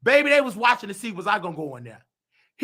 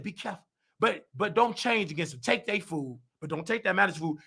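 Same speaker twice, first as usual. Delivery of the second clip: a hint of a soft, lower spirit's warning; hushed spirit voice; 4 semitones lower, 8 dB quieter, faster than usual. The spirit warning him about the manager's food, softer and lower. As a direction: murmur it like an inner voice cautioning you. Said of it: "The spirit said".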